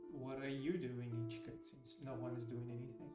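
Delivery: ordinary talk, nothing marked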